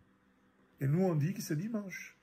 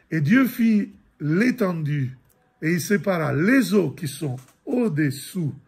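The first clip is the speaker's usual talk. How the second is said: loud, measured reading of Genesis; scripture reading; 6 dB louder than usual. Acted. Teacher reading Genesis aloud to the group, louder and measured. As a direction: proclaim the verses to the room.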